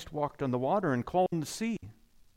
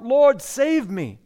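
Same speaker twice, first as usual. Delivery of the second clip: slow, loud cry for rescue; 4 semitones higher, 8 dB louder, slower than usual. Acted. Peter sinking in the water, crying out loud and slow. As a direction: plead loudly and slowly like a sinking man. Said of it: "Peter cried out".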